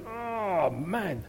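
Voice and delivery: drawn-out, soft weary "oh, man"; frustrated sigh. Acted. Someone facing a change that won't come, weary and frustrated. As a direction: let it sag out quietly, exasperated.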